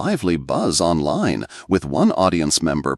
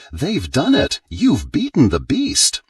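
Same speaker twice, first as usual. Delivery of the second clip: mildly raised, higher-pitched narrated shout; excited shout; 7 semitones higher than usual. A narrator's reading. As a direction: call out the win at a higher pitch, without full volume. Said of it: "Bradley shouting".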